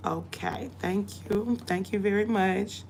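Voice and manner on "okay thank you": usual speaking voice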